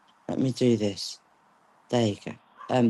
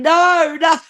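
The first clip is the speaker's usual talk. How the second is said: high, loud playful wail of no; mock screaming protest; 12 semitones higher, 4 dB louder than usual. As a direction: whine no like a child dragged off. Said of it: "kicking and screaming".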